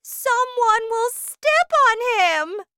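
high, loud, alarmed wail; mother's panicked cry. A narrator's reading. Mother fearing for her tiny son, panicked cry. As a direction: cry out in horror. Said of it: "cried Missus T V".

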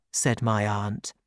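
ordinary delivery, nothing marked

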